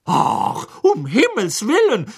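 high exasperated outburst; fed-up exclamation at the elves; angry. A narrator's reading. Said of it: "sagte Hermine wütend".

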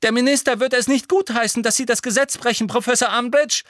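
high, loud and fast; calling out. A narrator's reading. Hermine warning Umbridge she breaks the law, loud. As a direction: hurl the warning at her. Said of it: "rief Hermine".

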